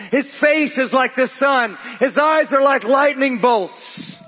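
ringing, emphatic pulpit delivery; high, forceful preaching. Real, not acted. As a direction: proclaim it to the whole congregation.

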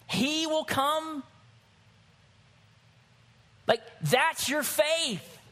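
high-pitched, emphatic sermon climax; impassioned preaching. Real, not acted. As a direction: proclaim it to the congregation with conviction.

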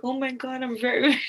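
light imitation of a fretting Canadian; mock gushing Canadian. Imitating kind Canadians apologizing.

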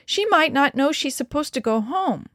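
put-on urgent child's pleading. A narrator's reading. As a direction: beg on the verge of tears. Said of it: "Denny pleaded".

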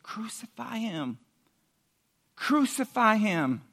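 ordinary talk

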